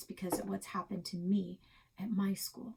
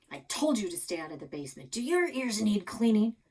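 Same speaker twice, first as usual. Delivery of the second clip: scolding grandpa; 3 semitones higher, 6 dB louder than usual. Acted.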